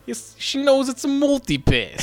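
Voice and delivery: Brooklyn accent. Shown as a demonstration. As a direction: do the Fifth Element Brooklyn accent. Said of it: "How do you say it? Do it".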